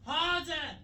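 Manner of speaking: high, slow mock cry of passion; a hammed-up high call in bed; mock shout. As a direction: squeal it out, drawn long and high. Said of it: "this girl just randomly shouted".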